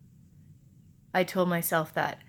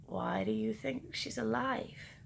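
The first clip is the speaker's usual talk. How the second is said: soft, slow, careful question; hushed father questioning a child; gentle father; 4 semitones higher, 10 dB quieter than usual. Acted. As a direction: ask it very softly and slowly, higher.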